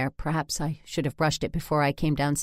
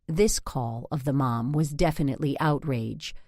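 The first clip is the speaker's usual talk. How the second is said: usual speaking voice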